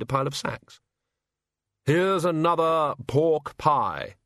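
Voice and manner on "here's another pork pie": slow, deliberate higher voice; measured and heavy, handing over food; slow Hogfather. Acted. Hogfather passing a pie to the sacks, slow.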